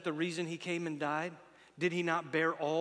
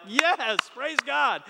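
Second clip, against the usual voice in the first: joyful praise; 8 semitones higher, 10 dB louder, much slower than usual. Retold or imitated. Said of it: "I'm like".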